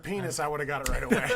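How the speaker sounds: normal talking voice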